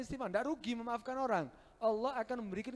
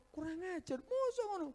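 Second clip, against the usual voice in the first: higher, indignant mock outburst down the phone; mock phone rant; 11 semitones higher than usual. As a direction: act out an outraged caller. Angry person on the phone.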